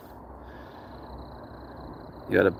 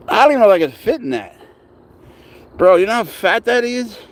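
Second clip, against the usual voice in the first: agitated rant; 9 semitones higher, 6 dB louder than usual. Real, not acted. Panicked complaint about slide.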